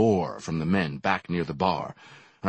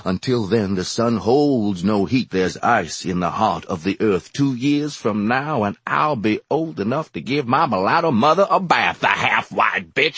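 louder, climbing into a furious rant; building fury; 3 semitones higher, 5 dB louder than usual. A narrator's reading. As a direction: let the rage explode by the end. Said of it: "explosion of glassy-eyed fury".

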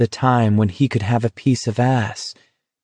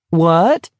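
high, rising mock query; merry cry; 7 semitones higher, 4 dB louder than usual. A narrator's reading. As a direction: pretend not to hear, merrily, pitched up. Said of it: "McVrees cried merrily".

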